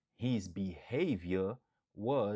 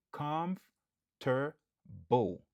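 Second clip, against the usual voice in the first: Comfortable drawn out slowly and higher; slow careful model word; 4 semitones higher, much slower than usual. Shown as a demonstration. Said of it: "Nou aksantye sou premye silab lan".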